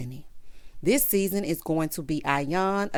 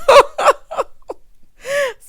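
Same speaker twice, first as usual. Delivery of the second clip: a big, high, real burst of laughter; loud high cackle; 12 dB louder than usual. Real, not acted. Laughing hard at her own spin on the recap, a real loud cackle.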